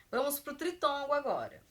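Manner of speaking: ordinary talk, nothing marked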